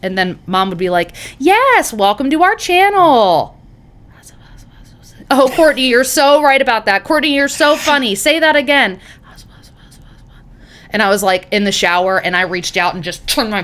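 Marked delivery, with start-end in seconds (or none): 1.4-9.0 high, loud booming mom voice; mock the mom blasting through the speaker; hammed-up loud mom, too close to the mic; imitating mom booming. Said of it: "this is what it sounded like".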